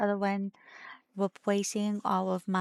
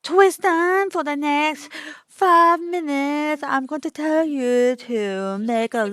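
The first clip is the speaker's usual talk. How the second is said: mock French accent; 4 semitones higher, 5 dB louder than usual. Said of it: "They recommended French".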